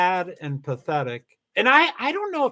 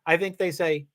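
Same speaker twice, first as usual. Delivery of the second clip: ordinary delivery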